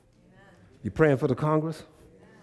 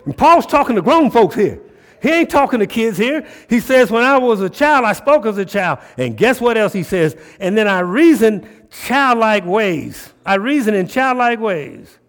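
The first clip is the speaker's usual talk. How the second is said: high, loud preacher challenging the room; fired-up preaching; 7 semitones higher, 8 dB louder than usual. Acted. Preacher challenging the men in church to man up, fired-up preaching. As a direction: push it out hard, stretching key phrases.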